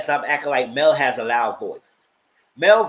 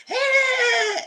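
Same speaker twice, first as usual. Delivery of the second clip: very high mock squeal; Mel's squeaky voice; 3 dB louder than usual. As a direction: imitate an upset, squeaky shout. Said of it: "And then her squeaky voice".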